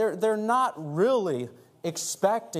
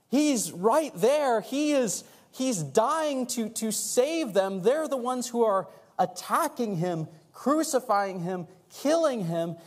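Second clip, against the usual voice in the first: raised pitch, drawn-out emphatic phrases; fervent preaching; 7 semitones higher, slower than usual. Real, not acted. Preacher marvelling at compassion from the cross, fervent. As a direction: preach it slowly, lifting each key phrase.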